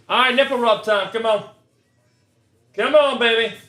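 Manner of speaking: urging the pack on, pumped; hyped up. Real, not acted. Ripping a pack, begging the cards for a hit, excited. Hoping for a big pull.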